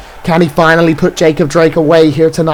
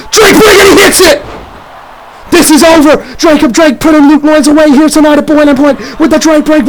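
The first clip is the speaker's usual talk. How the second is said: ecstatic shout; 8 semitones higher than usual. Acted. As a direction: blow the roof off as it lands. Finisher hits, match seems over.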